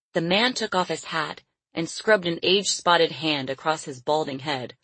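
ordinary delivery

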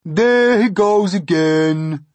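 slow, high, loud complaint; exasperated earthworm. Acted. Earthworm fed up with centipede's boasting, exasperated cry. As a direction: wail it slowly in exasperation. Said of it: "the earthworm cried".

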